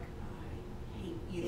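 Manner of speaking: very soft, low mock hatred; low seething reply. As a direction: say it low and spent, with quiet loathing. Exhausted runner's hostile reply.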